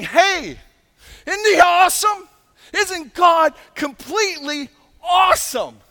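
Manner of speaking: loud, high, awestruck shout; angels calling out. Acted. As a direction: call out in wonder to the others. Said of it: "they're calling to one another".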